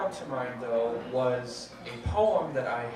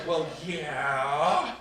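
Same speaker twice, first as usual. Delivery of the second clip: sarcastic; 5 dB louder than usual. Retold or imitated. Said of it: "a few people actually did say it that way".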